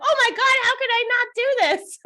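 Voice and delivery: high, hammed-up gasp of awe; mock buyer excitement. Mocking a trade show shopper, hammed-up excited. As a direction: play the dazzled shopper.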